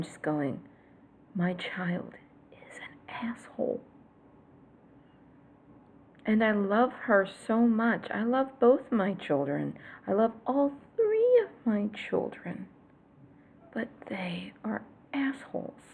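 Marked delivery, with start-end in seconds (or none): 1.3-3.8 whisper. Real, not acted. Confessing child is an asshole. 13.7-15.8 whisper. Real, not acted. Confessing kids are assholes.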